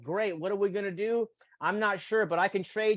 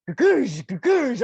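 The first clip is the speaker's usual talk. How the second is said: loud, higher mock bumping noise; imitated pothole thuds; 6 semitones higher, 10 dB louder, slower than usual. Driving down a bumpy road, loud mock bump sound. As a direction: bounce out the sound of the car hitting holes.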